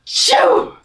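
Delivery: high, loud hammed-up sneeze with a sharp intake; huge mock sneeze. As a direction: do a giant playful bear sneeze. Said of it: "gave an enormous sneeze".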